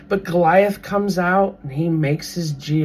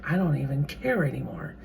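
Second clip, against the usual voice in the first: whispered giving up; 3 semitones lower, 9 dB quieter than usual. Retold or imitated. Worn-down person giving up.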